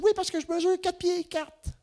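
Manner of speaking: a small woman in the elevator introducing herself in a very high voice; tiny woman's voice. Retold or imitated. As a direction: chirp the name like a tiny lady. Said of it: "elle a une petite voix".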